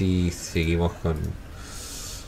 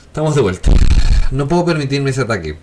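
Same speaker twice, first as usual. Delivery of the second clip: excited; 6 semitones higher, 7 dB louder, faster than usual. Real, not acted. Gamer back in the fight.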